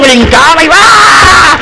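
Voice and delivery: loud high whoop dragged out slowly; reenacted cheer shout. Father reenacting his whoop at his son's basketball game, loud and drawn out. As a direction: let out a slow whoop like a fan on his feet.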